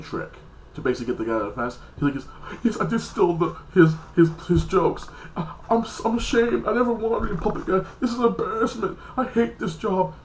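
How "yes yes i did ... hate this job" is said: much higher, strained confessing voice; a high, whiny mock of the ashamed puppet guy; ashamed confession. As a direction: squeak out a guilty confession, pitched way up. Puppet guy confesses his shame.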